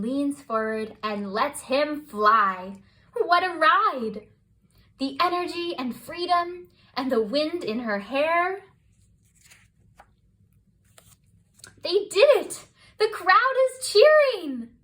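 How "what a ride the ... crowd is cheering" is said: building to a loud, high cry of triumph; excited. A narrator's reading. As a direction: let the joy burst out as they cross the line. Triumphant finish of the race.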